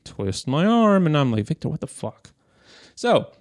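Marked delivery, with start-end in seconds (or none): 0.0-1.0 high, slow, coy giveaway; eager yes. Retold or imitated. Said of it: "But out in my mouth came out". 1.5-2.3 much softer, higher, scolding yourself under your breath; muttered self-scold. Retold or imitated. Inner scolding of himself.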